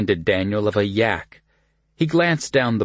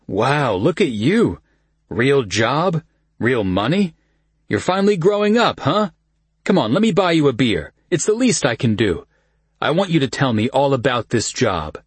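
excited; 6 semitones higher than usual. A narrator's reading. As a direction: cheer him on, big and bright. Kyle's teasing praise.

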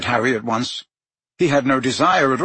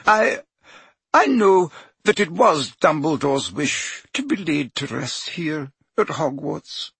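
voice shaking; 5 semitones higher than usual. A narrator's reading. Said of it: "her voice shook".

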